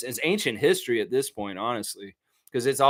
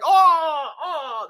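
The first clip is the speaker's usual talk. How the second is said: high, loud pained yelp, then a laughing whimper; mock pained cry; 5 dB louder than usual. Mimicking a player crying out as his nose hairs are ripped. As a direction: imitate the wax-ripping pain. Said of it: "And he's like".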